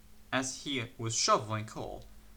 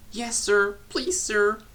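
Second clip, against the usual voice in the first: higher, timid little replies; nervous; 9 semitones higher, 5 dB louder, slower than usual. A narrator's reading. Percy apologizes to the controller, higher and nervous. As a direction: quaver apologetically. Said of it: "said Percy nervously".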